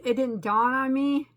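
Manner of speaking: ordinary delivery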